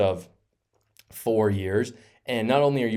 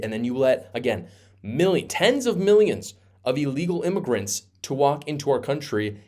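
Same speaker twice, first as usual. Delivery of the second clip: ordinary talk, nothing marked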